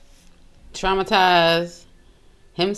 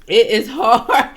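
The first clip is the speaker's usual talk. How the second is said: louder, drawn out slowly through a laugh; 3 semitones higher, 6 dB louder, slower than usual. Real, not acted. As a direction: let "It is hard" burst out through laughter. Laughing at the story.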